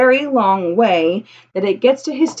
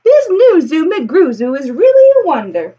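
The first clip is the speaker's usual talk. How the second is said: amazed townsfolk; 6 semitones higher, 5 dB louder than usual. Acted. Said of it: "they will say".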